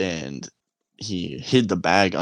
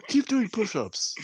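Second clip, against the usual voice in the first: much higher, mock annoyed sergeant; drill sergeant; 14 semitones higher than usual. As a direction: brush him off like a sergeant. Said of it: "He's like".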